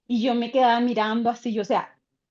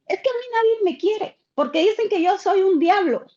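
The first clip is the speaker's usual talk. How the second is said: put-on a lightly childlike voice, much higher; voicing the boy; 8 semitones higher than usual. Teacher voicing the boy who says nobody loves him, high and childlike. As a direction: speak as a hurt little boy, pitched up.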